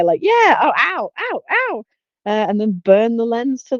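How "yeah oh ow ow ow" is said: mock pain yelps. Acting out dumb patient's peroxide.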